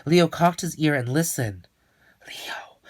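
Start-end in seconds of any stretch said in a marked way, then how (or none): 2.3-2.8 unvoiced hushed name; whisper. Leo hears a name inside the hat, whispered. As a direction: breathe the name like a secret.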